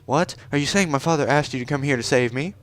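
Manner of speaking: put-on high-pitched haughty young princess; haughty princess Ruto. Princess Ruto introducing herself to the stranger, high and proud.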